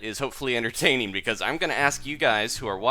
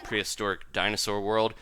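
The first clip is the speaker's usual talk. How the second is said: ordinary delivery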